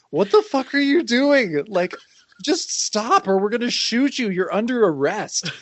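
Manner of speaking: high-pitched, incredulous outburst; acting out a fed-up policeman; exasperated cop. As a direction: order him to stop in disbelief. Acts exasperated cops shouting at Hangman.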